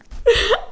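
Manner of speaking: high, loud giggle; high burst of laughter. Real, not acted. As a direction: laugh out with glee. Laugh after greeting.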